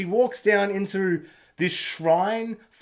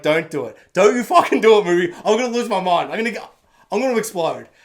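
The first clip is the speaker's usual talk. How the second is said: rising, louder frantic pleading; mock exasperated outburst; 6 dB louder, faster than usual. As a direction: burst out as if losing your mind. Begging the movie not to do it.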